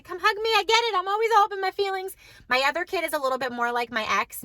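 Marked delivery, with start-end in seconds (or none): 0.0-2.2 much higher, sing-song comforting coo; cooing to her son. Retold or imitated. Mom comforting her emotional son, high tender coo. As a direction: coo it high like hugging your crying boy. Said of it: "I'm like".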